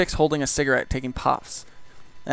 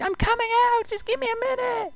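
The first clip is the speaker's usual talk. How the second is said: very high, squealed whiny impression; mock crying woman. Woman behind washroom door stalling, mock-crying. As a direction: wail it in an exaggerated falsetto, mocking. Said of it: "she's, like, crying or whatever".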